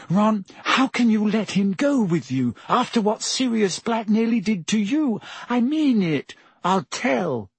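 high, insistent schoolgirl; Hermione voice. Acted.

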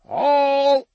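high, loud shout of a name; calling out. A narrator's reading. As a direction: call to someone from far off. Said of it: "He heard someone calling his name".

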